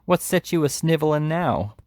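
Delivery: high, mocking sneer; taunting. A narrator's reading. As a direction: jeer at him from above. Noah taunting Oliver.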